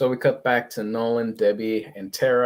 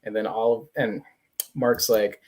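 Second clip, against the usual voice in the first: ordinary delivery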